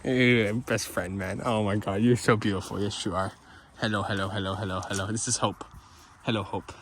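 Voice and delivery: soft, low cooing to a pet; talking to Hope the dog, soft and doting. Real, not acted. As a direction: coo at the dog gently.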